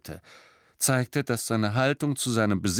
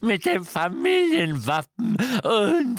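slow, high, louder wailing croak; croaking elf; 12 semitones higher, 4 dB louder, slower than usual. A narrator's reading. Creature grieving to Harry over stolen heirlooms. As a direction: lament each lost treasure, rising.

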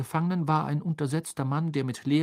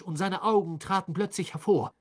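ordinary delivery, nothing marked

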